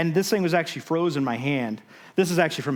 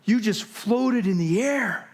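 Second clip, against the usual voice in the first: amazed coworker; 7 semitones higher, slower than usual. Retold or imitated. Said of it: "And he goes".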